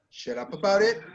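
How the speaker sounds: "Shut up about it" rising on "it"; rising end imitated. Shown as a demonstration. Teacher imitates the student's rising sentence end. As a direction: exaggerate the upward tune on "it". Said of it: "go up on the intonation".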